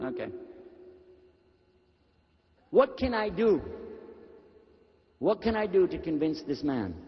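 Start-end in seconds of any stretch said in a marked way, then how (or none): none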